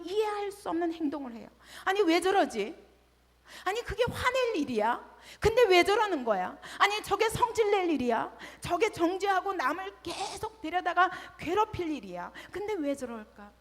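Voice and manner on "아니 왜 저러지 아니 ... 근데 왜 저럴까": exasperated bafflement. Retold or imitated. Voicing puzzled onlookers.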